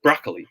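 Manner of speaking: "Broccoli" said as BRAH-klee, middle swallowed; unemphasized KA. Shown as a demonstration. Teacher models the right answer, BRAH-klee broccoli. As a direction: model "Broccoli" without stressing the middle syllable. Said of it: "hindi siya emphasized".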